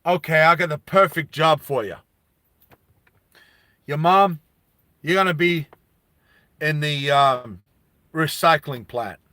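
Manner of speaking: loud, cocky synth foreman; punchy taunts in separate bursts; brash foreman. Acted. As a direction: play a mouthy boss needling the new workers.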